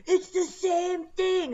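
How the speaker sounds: high, loud and slow indignant whine; whiny customer. Retold or imitated. Mimicking the entitled customer insisting to the clerk. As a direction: drag it out like an entitled shopper.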